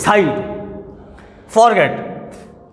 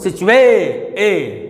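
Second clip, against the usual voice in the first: "सिचुए" stretched on ए, then ए alone; stress on A; slower than usual. Shown as a demonstration. Teacher isolating the stressed syllable, "सिचुए" drawn out on ए. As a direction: hold and repeat the ए of "सिचुए". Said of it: "stress किस पर है?".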